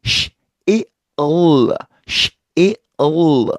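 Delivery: 실 as 시, 이, 을 apart; broken-up sil; split sil. Shown as a demonstration. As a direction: pull 실 into three sounds. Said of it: "한꺼번에 안 돼요".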